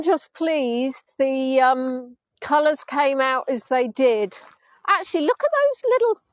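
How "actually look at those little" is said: delighted. Real, not acted. Delighted at sky colours.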